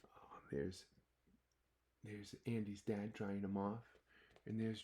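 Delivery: soft unvoiced aside; whisper. A narrator's reading. Storyteller pointing out the picture to kids, whisper. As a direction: whisper it to the children.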